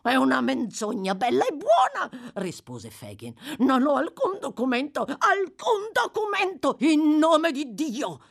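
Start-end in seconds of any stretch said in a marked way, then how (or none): 0.1-2.3 put-on high, loud protesting old rogue; heated high-pitched retort; indignant denial. 3.6-6.8 high, loud wailing protest; crazed old rogue's cry; frantic denial. Acted. 6.8-8.2 loud, slow, high-pitched adjuration; solemn, forceful entreaty; solemn appeal. A narrator's reading. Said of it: "in tono solenne".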